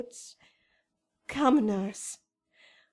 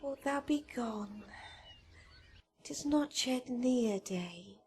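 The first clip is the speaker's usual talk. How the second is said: tender whisper; 8 dB quieter than usual. Acted. Juliet pleading at dawn parting.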